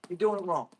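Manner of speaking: ordinary delivery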